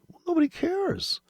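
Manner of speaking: very high, exaggerated brush-off; mock dismissal. Trader answering a boasting YouTuber, mock dismissive. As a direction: wave it away in a high mock voice. Acted reply to bragging YouTuber.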